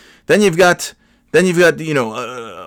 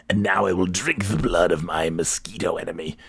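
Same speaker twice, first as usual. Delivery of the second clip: low, quieter gloating villain voice; mock villain; 5 semitones lower, 6 dB quieter than usual. Mock gloating over slain mosquito.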